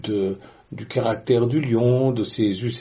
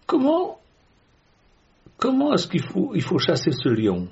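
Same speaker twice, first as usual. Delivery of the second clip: much higher, anxious questioning; mock panic; 6 semitones higher than usual. Listener asks how to chase the lion, in mock panic. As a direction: play a flustered believer needing an answer.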